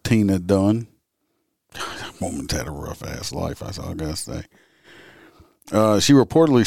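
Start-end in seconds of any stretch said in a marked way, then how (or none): 1.7-4.4 whispering. Real, not acted. Aside about the suspect's hard life.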